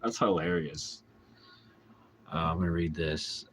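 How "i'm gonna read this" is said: usual speaking voice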